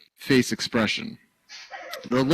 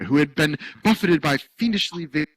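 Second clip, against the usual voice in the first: ordinary delivery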